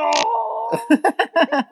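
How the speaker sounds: a real, high-pitched burst of laughing; high burst of laughter. Real, not acted. Recalling the desperate driver moment, real high laughter. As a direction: break into laughter at the memory. Laughing after retelling the shout.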